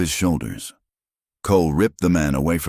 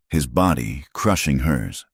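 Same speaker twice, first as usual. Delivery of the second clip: plain reading voice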